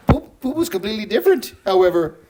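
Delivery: high-pitched, leprechaun-like lecturer; Jordan Peterson. Acted. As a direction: insist earnestly in a high comic voice. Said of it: "you kinda sound like a leprechaun".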